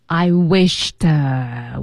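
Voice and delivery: slow "wished", left hanging; halting, stopped midway. Acted. Marty about to confess his wish, halting. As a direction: stretch it out, then hold back. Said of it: "停了半截儿".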